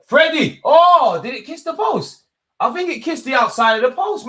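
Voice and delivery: high, loud burst of live excitement; excited shout. Real, not acted. Watching his free kick, excited high shout. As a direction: yell at the screen as the shot nearly goes in.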